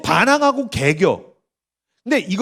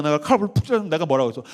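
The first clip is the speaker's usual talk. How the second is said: normal talking voice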